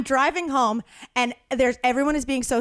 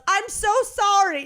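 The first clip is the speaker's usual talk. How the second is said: retold yell; 8 semitones higher than usual. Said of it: "he yelled".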